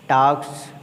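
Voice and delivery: plural s kept voiceless after k; "talks" ending in s. Shown as a demonstration. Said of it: "एस का pronunciation सा". ("talks" के अंत में स की ध्वनि)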